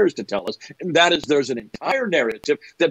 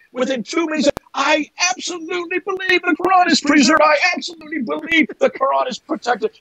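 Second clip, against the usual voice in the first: mocking mantra; 9 semitones higher, 3 dB louder than usual. Mimicking a believer reciting his mantra, high mock voice. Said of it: "he went into that mantra".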